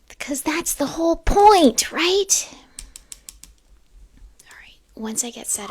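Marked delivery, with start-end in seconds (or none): 0.1-2.5 breaks the whisper, loud and high; sudden loud outburst. Real, not acted. Explaining to viewers why, suddenly loud. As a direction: blurt it out full voice.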